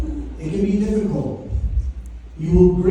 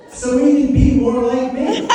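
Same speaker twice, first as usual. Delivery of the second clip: laughing punchline; 8 semitones higher than usual. Real, not acted. Repeated punchline, laughing, pitch up.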